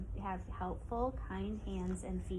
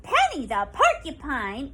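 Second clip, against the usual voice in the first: much louder startled cry; alarmed exclamation; 5 semitones higher, 13 dB louder than usual. Acted. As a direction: blurt out the name in shock. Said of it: "exclaimed Susan Squirrel".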